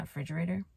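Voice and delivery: "refrigerator" said as "fridgerator", first syllable missing; quoting the slip. Shown as a demonstration. Vlogger pointing out her mistake, "refrigerator" shortened to "fridgerator". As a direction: drop the "re" from "refrigerator". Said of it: "and then I said refrigerator".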